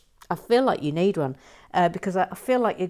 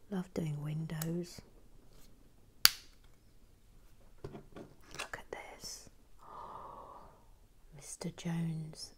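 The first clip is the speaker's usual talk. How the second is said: soft hushed whisper while building; 3 semitones lower, 15 dB quieter than usual. Real, not acted. Host murmurs to viewers while finishing the bag, whispered. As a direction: keep it hushed and close as if not to wake anyone.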